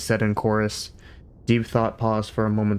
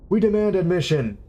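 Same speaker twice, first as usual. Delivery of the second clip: high, loud demanding shout; bursting in, shouting out the demand; 9 semitones higher, 3 dB louder than usual. A narrator's reading. Philosopher bursting into the computer room, shouting. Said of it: "shouted the younger of the two men".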